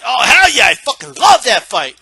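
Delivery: loud, whooping enthusiasm; acted burst of happy excitement; excited fan. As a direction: shout it like a thrilled fight fan. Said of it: "what's wrong with just being excited?".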